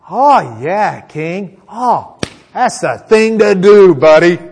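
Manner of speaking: mock-enthusiastic cheering on of the king; very sarcastic. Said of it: "very sarcastically".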